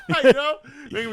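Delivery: high, loud, laughing through words. Real, not acted. Joking with friend about seventh graders, laughing loud. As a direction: crack up while joking.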